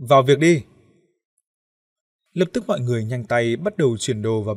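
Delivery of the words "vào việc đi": loud, brisk order; calling out loudly. A narrator's reading. Group leader ordering everyone to start work, calling out loudly. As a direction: call the group to action in a raised voice. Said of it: "Ông Lý hô lớn".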